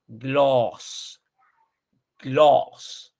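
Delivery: "gloss" with the O held long. Shown as a demonstration. Teacher correcting students' vowel, "gloss" with long O. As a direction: draw "gloss" out slowly on a rounded O. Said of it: "Larga la, la O".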